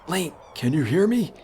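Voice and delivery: put-on high, far-off old sage voice; old man voice. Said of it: "it's like an old man voice".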